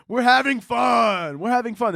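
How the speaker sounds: mock cheer. Comic to audience after a hell joke, mock cheering. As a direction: call it out high, insisting.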